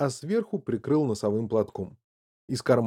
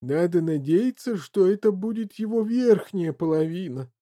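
higher-voiced glum husband; gloomy; 7 semitones higher than usual. A narrator's reading. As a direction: voice a grim hope. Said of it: "мрачно проговорил её супруг".

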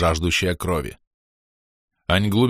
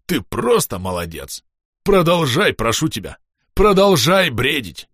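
overjoyed praise; 4 semitones higher than usual. A narrator's reading. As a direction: urge him on with heartfelt delight. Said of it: "утёр слёзы счастья". (восторженно, нараспев умоляя)

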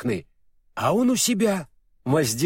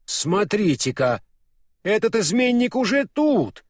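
astonished, higher-pitched exclamation; surprised; 7 semitones higher than usual. A narrator's reading. Фарамант spots the traitor beside the witch, surprised. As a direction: exclaim in surprise at an unexpected sight. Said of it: "удивился Фарамант".

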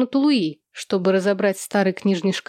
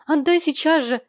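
higher-pitched urgent demand; indignant high order; demanding cry; 6 semitones higher than usual. A narrator's reading. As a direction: insist on it, voice pitched up. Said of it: "крикнула она".

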